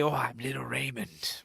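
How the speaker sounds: hushed, whispery mock introduction; creepy mimicry. Mocking a channeler who claims to be Little Raymond, creepy half-whisper. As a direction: say it low and whispery, imitating a creepy spirit. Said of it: "See, this is creepier".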